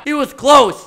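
ordinary delivery

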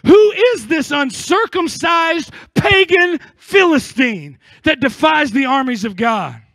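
David shouting down Goliath; defiant shout. Acted. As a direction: roar it at the giant. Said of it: "David's response to the giant was".

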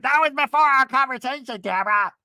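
loud, high squawked comeback; hammed-up outrage; mock indignant retort. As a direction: imitate a reality star arguing back. Acting Vicky snapping back at Tamara.

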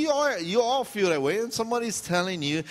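usual speaking voice

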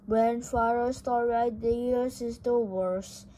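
plain reading voice

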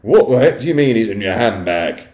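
loud, baffled outburst; indignant shout. A narrator's reading. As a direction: shout it in angry disbelief. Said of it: "Mister Jenkins shouted".

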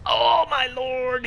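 loud drawn-out oh my lord; shocked shout. Real, not acted. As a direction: cry out in shock. Killed by enemy, shout.

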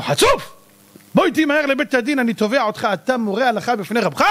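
high, indignant scolding; acted outrage. Rabbi hauling an upstart to court, indignant outburst. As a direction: burst out high on the insult, then press the accusation.

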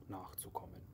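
plain reading voice

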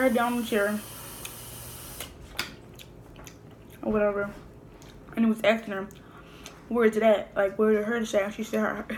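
eating between words; talking while chewing. Real, not acted. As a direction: mouth partly full of food. Seen 5: Picks crab meat, hand to mouth at 0.9 and 1.8 while talking.

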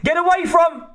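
urgent, very high-pitched scream; screamed warning. A narrator's reading. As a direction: scream at them to get clear. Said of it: "screamed Kozlowski".

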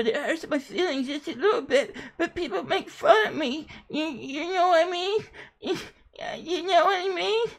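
high voice breaking into fake sobs and laughs; hammed-up weepy whine; mock crying. Sweetie whining to the interviewer about being mocked, mock crying. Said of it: "Don't, don't cry".

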